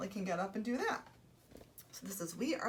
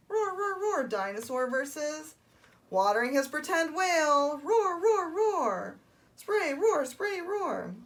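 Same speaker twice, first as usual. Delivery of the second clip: high, excited roars; roaring toddler dinosaur; 7 semitones higher, 8 dB louder, slower than usual. Acted. Storyteller stages dinosaur versus pretend whale, high and roaring. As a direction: roar playfully between big announcements.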